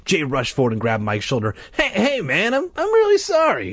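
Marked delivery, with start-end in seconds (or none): 1.7-3.7 high, stumbling apology; flustered apology. A narrator's reading. Jay apologising to bitten Mike, flustered and high. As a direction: apologise anxiously, voice pitched well up.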